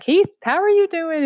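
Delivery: put-on greeting an old friend with bright surprise; excited greeting. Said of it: "I was like".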